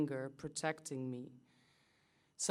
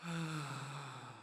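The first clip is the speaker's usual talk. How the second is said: soft drawn-out exhale; long audible sigh; 7 dB quieter than usual. Acted. Sound after breathing refrain.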